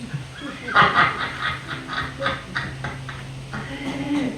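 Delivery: long real laugh, mostly voiceless. Real, not acted. Preacher joking that the chair got blessed, long laugh. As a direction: laugh on helplessly at your own joke.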